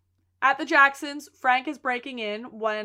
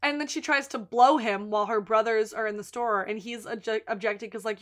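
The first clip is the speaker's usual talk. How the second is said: ordinary delivery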